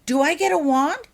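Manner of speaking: put-on high, loud, eager girl's question; eager child. Holly asks Jenny about getting a wand, high and eager.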